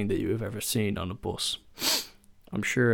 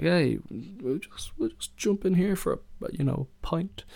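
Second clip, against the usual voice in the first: carefree suggestion; 8 semitones higher than usual. Retold or imitated.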